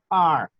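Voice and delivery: R said as "ar", long and untrilled; name R the English way, tongue curled back; English letter R. Shown as a demonstration. Teacher saying the English letter name, R as a drawn-out "ar". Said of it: "fíjense cómo se dice R en inglés".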